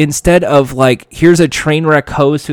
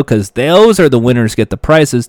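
ordinary talk, nothing marked

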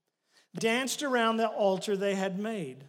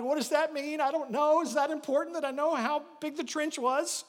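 baffled listener; 5 semitones higher, 3 dB louder than usual. Retold or imitated. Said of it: "And you're like".

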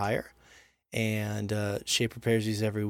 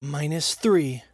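slow, louder drawn-out callout; slow shout of the deficit; calling the score; 4 semitones higher, 5 dB louder, much slower than usual. Acted. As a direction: stretch it out like an announcer.

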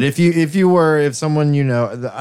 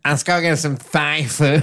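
higher, slow, silly politician impression with "thigh" for Thai; mocking DeSantis voice; 5 semitones higher than usual. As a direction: put on a weird voice to mock him. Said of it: "he did it with that voice".

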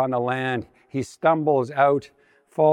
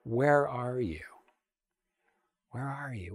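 gentle calling; 9 dB quieter than usual. Acted. God calls to Adam and Eve in the garden, softly. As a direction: call out softly as if seeking someone. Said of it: "the first question God poses".

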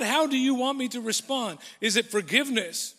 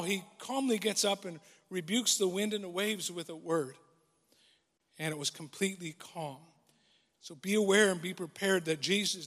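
soft, settled preaching after the peak; calm sermon; 3 semitones lower, 7 dB quieter than usual. Acted. Pastor reassuring the congregation after the storm, calm soft voice. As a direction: bring it down gently, like the calmed sea.

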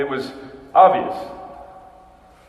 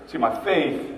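ordinary delivery

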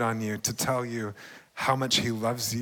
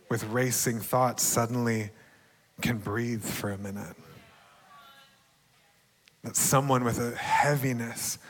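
ordinary delivery

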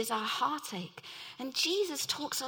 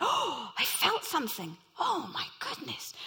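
retold astonishment at sudden healing; shocked; 4 semitones higher than usual. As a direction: blurt it out stunned. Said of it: "he was shocked".

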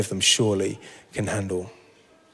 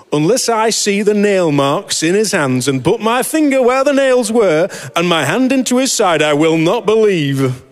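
broad, loud Yorkshire voice, pitched up; Yorkshire accent; 8 semitones higher, 5 dB louder than usual. Retold or imitated. Thomas refusing the disciples' news, in a hammed-up Yorkshire accent. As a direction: play doubting Thomas as a blunt Yorkshireman. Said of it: "I always imagine this with a Yorkshire accent".

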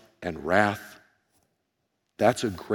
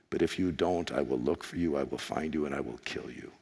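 low, soft, hoarse menacing phone threat; dad threatening the kidnapper on the phone, low hoarse menace; Liam Neeson impression; 6 semitones lower, 4 dB quieter than usual. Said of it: "this sounds better with my hoarse voice".

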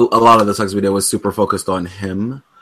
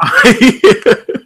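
laughing; 14 semitones higher, 9 dB louder than usual. Real, not acted. Laughs at stuck candy.

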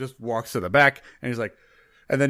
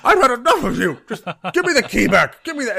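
much higher and much louder, a barman losing it; furious outburst; 12 semitones higher, 9 dB louder than usual. Acted. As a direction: shout him down in pure exasperation. Bartender demands the key back.

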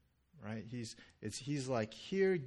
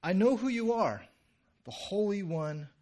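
loud, higher-pitched declaration; demon's outcry; 7 semitones higher, 8 dB louder than usual. Acted. Demon calling out to Jesus in a sermon, loud. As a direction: cry it out as the demon, loudly. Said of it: "this demon is saying".

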